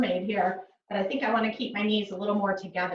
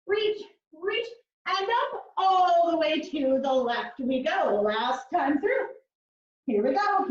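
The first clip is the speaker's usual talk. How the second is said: higher, sing-song counting of reps; rhythmic workout cueing; 4 semitones higher than usual. Acted. Instructor counting reps for class in a sing-song cue. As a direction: chant the reps like a fitness instructor.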